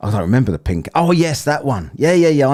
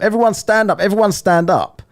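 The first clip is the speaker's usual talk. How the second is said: high-pitched mock stage command; imitated demand; 10 semitones higher, 3 dB louder than usual. Retelling a singer working her concert crowd, mock demanding. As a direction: order the whole crowd up, as the singer. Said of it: "she demanding everyone stand up".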